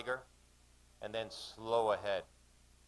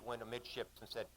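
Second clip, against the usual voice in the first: ordinary delivery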